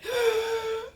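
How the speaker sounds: sharp high gasp of shock. Retold or imitated. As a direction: gasp like the news just hit you. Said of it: "my whole body went like this. I went".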